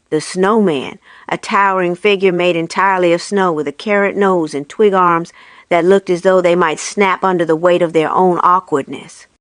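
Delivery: ordinary delivery, nothing marked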